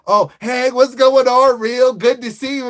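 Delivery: high, loud sugary mock hello; hammed-up fake cheer; fake-friendly greeting. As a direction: gush like a two-faced friend. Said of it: "as I say".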